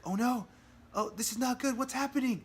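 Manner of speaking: a hammed-up cry of panic as the trap springs; panicked gasp. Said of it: "All of a sudden, she's like".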